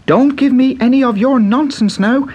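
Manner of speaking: put-on high, eager young Irish boy; bright boyish Irish lad; Pat's boy voice. A narrator's reading. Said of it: "he said".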